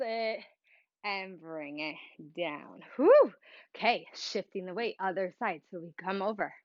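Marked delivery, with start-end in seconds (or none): 3.0-3.3 high loud exhale of relief; relieved whew. Real, not acted. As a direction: blow out the held breath. Release after holding the pose.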